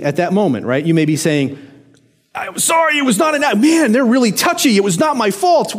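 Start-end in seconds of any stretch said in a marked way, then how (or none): 2.3-5.8 high, half-shouted excuse; mock defensive yell. Driver who cut someone off, defending himself with a mock yell. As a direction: protest your innocence, exasperated.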